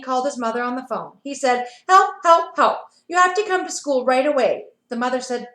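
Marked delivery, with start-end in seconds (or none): 1.9-4.7 calling for help. Acted. Ben calling mother for help.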